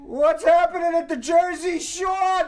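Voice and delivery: put-on Jersey accent. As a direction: ham up a New Jersey accent. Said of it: "laughing at my Jersey accent".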